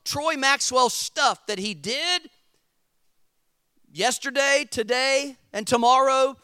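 loud, high-pitched sermon delivery; preaching at full force. Real, not acted. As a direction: drive each phrase home to the congregation.

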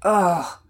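put-on loud, drawn boyish groan; exasperated "ugh". A narrator's reading. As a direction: groan in protest at bedtime. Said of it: "Pearson groaned".

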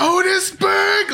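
"Otisburg!" bellowed very high, loud and slow; yelled out. Retold or imitated. Lex yelling at his minion Otis, drawn-out shout. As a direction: belt out "Otisburg!" like a proud villain. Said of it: "yells it out".

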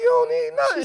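loud, very high pleading; hammed-up dad reassurance; retold protest. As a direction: insist in a squeaky, worked-up voice. Retold protest to his daughter.

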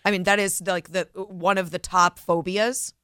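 ordinary talk

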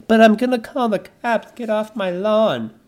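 high, loud mock whine of a threatened homeowner; hammed-up get-off-my-lawn complaint; indignant homeowner. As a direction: play the outraged suburbanite, whiny and pushed up high. Voicing indignant homeowner being robbed.

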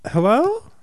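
high, bright hello; breezy high hello; ditzy phone greeting. Retold or imitated. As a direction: pick up the phone sweetly. Chloe answering phone.

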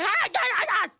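high, loud staccato "ah" bursts; imitated table-slapping outburst; mock yelling. Imitating Iyanla slapping tables on her show, mock yells. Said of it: "slapping the tables and be like".

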